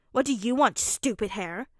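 loud grumble that drops off; grumbling. A narrator's reading. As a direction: grumble irritably at a friend. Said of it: "Bakugo grumbled".

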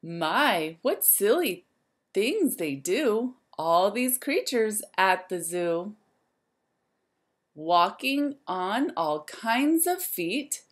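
lively, sing-song storybook voice; reading to children. A narrator's reading. Teacher reading a rhyme book to the class, lively and sing-song. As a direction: wake the zoo up like a bright morning call.

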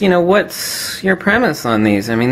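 usual speaking voice

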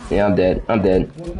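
ordinary talk, nothing marked

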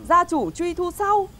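each word drawn out slowly; slow deliberate reading. Acted. Neighbour reading the invitation's warning slowly and pointedly. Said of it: "bác nói thế thì hóa ra".

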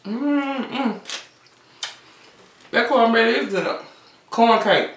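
chewing while talking; muffled through food; mouth full. Real, not acted. Seen 1: bites cornbread at -1.0, chews with closed full cheeks throughout.

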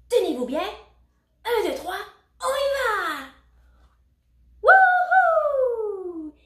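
high, loud launch call into a whoop; excited countdown. A narrator's reading. Puppy launching toys down the stairs, exhilarated. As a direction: build up to a soaring wahoo.

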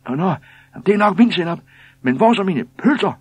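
very high, loud indignant demand; shrieking woman. A narrator's reading. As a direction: shriek for your missing order. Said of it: "skreg den fjerde".

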